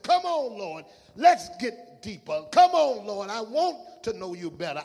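fervent pulpit exhortation; impassioned preaching. Real, not acted. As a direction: press the congregation with rhythmic, repeated appeals. Preacher exhorts congregation in rhythmic repetition.

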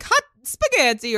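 put-on Italian accent. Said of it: "Mario would be like".

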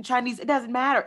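normal talking voice

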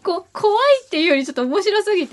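high-pitched, helpless amusement; laughing. Real, not acted. Listener finding the strange tale too funny, laughing. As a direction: speak while trying not to laugh.

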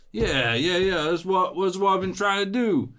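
loud, high, excited agreement; eager woozy hero. Acted. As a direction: agree loudly like a dazed would-be hero.

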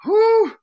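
high loud whew of overeating; exaggerated pained whew; pained exhale. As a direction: blow out like you're stuffed. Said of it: "I'm like".

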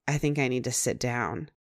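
quiet, dazed and low. Retold or imitated. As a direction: say it like the floor dropped. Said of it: "mouth hanging open".

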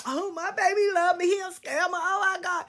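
mock naive girlfriend. Mocking a woman fooled by her scammer boyfriend, high and dreamy. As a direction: swoon over him like a fool.

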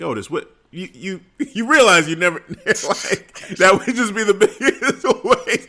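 laughing through an excited quote; cracking up mid-sentence. Retold or imitated. R-Truth voiced, laughing.

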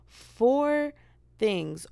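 high, loud disbelieving "Four"; shocked repeat. Real, not acted. Realizing how few topics got covered, shocked.

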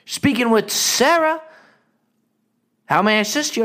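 breezy and relaxed, as if nothing could be urgent; nonchalant Sarah. Retold or imitated. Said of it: "It was the most nonchalant answer".

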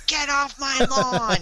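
loud, high, drawn-out grumpy neighbor yell; Mister Wilson. Acted. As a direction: holler it slowly like a cranky old neighbor. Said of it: "his voice would be more like Mister Wilson from Dennis the Menace".

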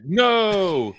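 spöttisch hoch gezogenes No, wie eine Filmfigur; mocking high No. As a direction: ein leicht gespieltes, hohes Nein. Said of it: "When they were like".

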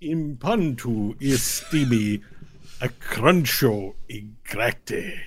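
slow, drawn-out invented tongue; Tabacho speaking Draconic. Acted. Tabacho questioning the visitors, slow Draconic pronouncement. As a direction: intone it grandly, stretching the syllables.

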